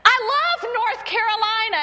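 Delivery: loud, high, hammed-up devotion; proud boyfriend's declaration. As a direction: proclaim it with mock fervor. Retells boyfriend's second declaration.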